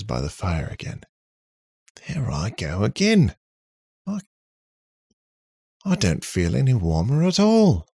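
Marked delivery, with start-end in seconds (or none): 2.1-7.9 higher, put-on robot character voice; cheeky robot character. A narrator's reading. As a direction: play a robot puzzling aloud, voice swooping up. Robot figure by the fire.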